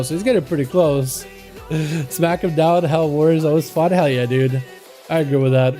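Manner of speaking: ordinary talk, nothing marked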